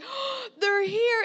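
high, loud gasp of delight; gasp, then stretch it out high and thrilled; excited gasp. Retold or imitated. Spots her grandbaby arriving at mall.

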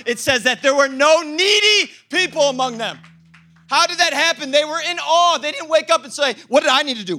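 loud, high preaching; full-force preaching. Acted. Pastor preaches about the early church, loudly. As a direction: drive it home like a revival preacher.

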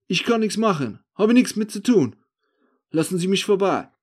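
loud, high-pitched protest; agitated denial. Acted. The accused man trying to get past, agitated denial. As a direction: protest innocence, pushing to get away.

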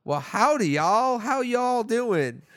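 high, slow, hammed-up Hollywood Southern drawl; Hollywood Southern accent. Mocking the English dub in a slow, high Hollywood Southern accent. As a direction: ham up a cowboy accent, slowly. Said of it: "really hard to get through the".

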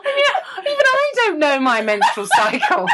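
high-pitched, laughing through the words; incredulous, giggly and high; laughing disbelief. Real, not acted. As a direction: protest helplessly through real laughter.

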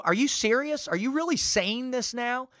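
high-pitched disbelief; incredulous. Retold or imitated. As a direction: challenge her in rising disbelief. Disbelieving reply to organizer.

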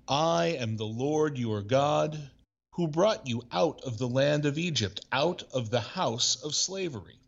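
a measured, formal Bible reading; scripture reading. Acted. Reads scripture text.

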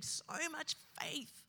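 slow, soft, aching; grieving. Real, not acted. Preacher remembering his dead friend's faith, slow and grieving. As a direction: let the grief slow it down. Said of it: "grieving a friend".

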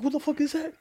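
very high, startled squeak; shocked squeal. Retold or imitated. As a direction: panic up into a squeal. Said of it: "I'm like".

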